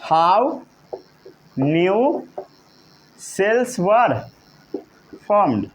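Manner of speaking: each word set apart by a long pause; drawn-out, evenly spaced words; slow dictation. Shown as a demonstration. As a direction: dictate word by word for note-takers. Teacher dictates for note-taking.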